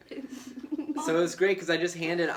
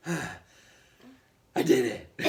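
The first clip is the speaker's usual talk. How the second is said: put-on very slow, worn-out kid; exhausted kid voice; much slower than usual. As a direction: drag it out, spent. Kid after five hours work.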